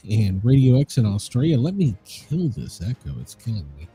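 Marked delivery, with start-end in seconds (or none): none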